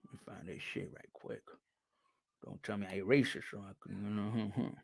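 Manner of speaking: very quiet, low mumble; muttering to himself. Real, not acted. Host hunting for his video, muttering to himself. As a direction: mumble under your breath while searching.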